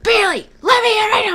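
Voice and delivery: high-pitched mock holler; imitated screaming. Locked-out boyfriend at girlfriend's door, imitated high yell. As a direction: demand entry like a locked-out drunk. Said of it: "You need to not scream".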